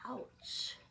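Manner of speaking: a breathy, hushed ouch; whispered little ouch of pain; soft ouch. Acted. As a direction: whisper the ouch, hurt and quiet. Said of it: "said Miss Merriweather softly".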